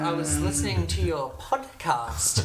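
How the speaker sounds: lightly put-on, sarcastic listener; mock ex-girlfriend. Ex to him about his podcast, mock sarcastic praise. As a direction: deliver hollow praise, leaning on each verdict. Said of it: "she doesn't talk like that".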